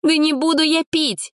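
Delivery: put-on high, insistent girl; high stubborn protest; stubborn refusal. A narrator's reading. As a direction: dig your heels in. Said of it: "продолжала упираться".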